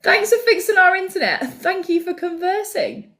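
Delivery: high, playful gratitude; hammed-up appreciation; gushing mock thanks. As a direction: thank him in a high, effusive voice. Acting out gushing thanks to Steve.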